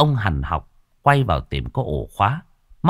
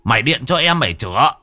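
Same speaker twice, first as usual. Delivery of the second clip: exasperated father; 5 semitones higher, 4 dB louder than usual. Acted.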